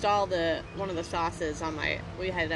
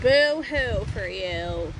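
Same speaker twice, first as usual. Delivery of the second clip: drawn-out, louder boo-hoo; mock pity; 2 semitones higher, 6 dB louder, much slower than usual. Real, not acted. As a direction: stretch it out slowly to tease. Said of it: "Well, you did buy me".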